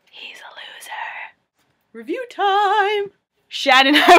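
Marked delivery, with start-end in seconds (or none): 0.1-0.9 whispered jab; hushed aside. Real, not acted. Teasing her friend who is busy gaming, whispered aside. Secret jab at Ryan playing nearby. 1.9-3.1 high, drawn-out announcer call; segment announcement. Real, not acted. Opening the next segment of the video, excited announcement.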